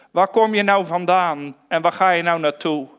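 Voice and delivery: ordinary talk, nothing marked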